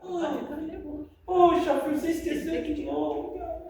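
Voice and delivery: light, friendly little nudge; gentle nudge. Pretend. Wife lightly reminding husband about the towel, friendly nudge. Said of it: "Ó, só aquele toque".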